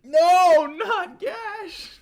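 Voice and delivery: loud high-pitched shriek; shrieking protest. Real, not acted. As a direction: scream it in horrified protest. Reacts to crude nickname.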